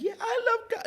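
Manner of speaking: very high-pitched gushing imitation; hammed-up giddy agreement; mock smitten. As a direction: squeal it like an eager admirer. Mimics Vanessa's smitten answer.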